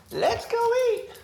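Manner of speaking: squeaky high pet voice, drawn out; high, slow sing-song dog call. Acted. Owner calls the dogs to dinner in a high slow sing-song.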